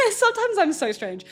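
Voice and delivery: high, laughing through the words; amused at her own oddness; laughing at herself. Real, not acted. Confessing a cafe moment to viewers, laughing.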